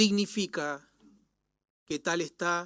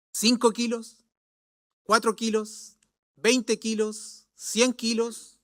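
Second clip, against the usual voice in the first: lightly mocking, higher-pitched challenge; mocking young man; 4 semitones higher, 5 dB louder than usual. Scoffing young man challenges a preacher, mocking. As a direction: goad the preacher with sceptical questions. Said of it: "que era bien burlesco".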